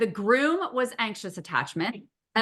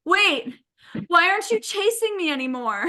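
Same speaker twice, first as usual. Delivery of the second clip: high, puzzled mock bride; hammed-up bride missing the chase; 8 semitones higher than usual. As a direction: ask it up high, suddenly confused. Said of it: "looks around and goes".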